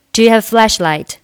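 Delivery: quick and run together, "have a" as "ha-vuh"; linked, natural speed. Shown as a demonstration. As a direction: say "Do you have a flashlight?" fast in one flow. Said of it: "了辅元连读".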